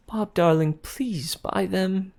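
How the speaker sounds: high, drawn-out wheedling; fiancée wheedling Bob to buy spoons; coaxing plea. A narrator's reading. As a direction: sweetly beg him to buy.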